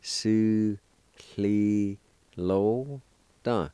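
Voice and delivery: emphatic syllable drill; syllable-by-syllable pronunciation. Shown as a demonstration. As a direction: say each syllable of Su cli lo dun apart. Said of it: "is pronounced like this".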